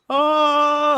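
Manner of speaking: loud, high zombie roar. Retold or imitated. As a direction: roar out like a waking corpse. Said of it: "randomly just goes".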